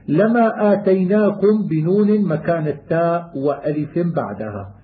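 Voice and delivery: usual speaking voice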